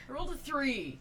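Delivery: normal talking voice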